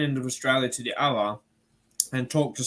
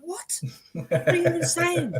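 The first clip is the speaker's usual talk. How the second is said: high confused voice. Retold or imitated. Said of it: "And I'm like".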